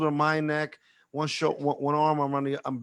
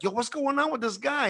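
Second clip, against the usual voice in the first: high-pitched, baffled demand; mock-incredulous, pitched way up; acted bewilderment; 10 semitones higher than usual. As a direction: imitate a bystander taken aback. [name] reacts to the drunk man.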